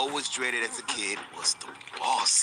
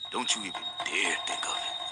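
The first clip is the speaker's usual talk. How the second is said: lower, softer menacing warning; low dad warning; 8 semitones lower, 5 dB quieter than usual. Retold or imitated. Dad warning his son not to run, low and quiet. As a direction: stop the kid cold with a quiet threat. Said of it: "my pops goes".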